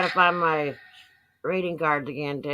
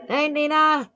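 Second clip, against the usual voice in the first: ordinary talk, nothing marked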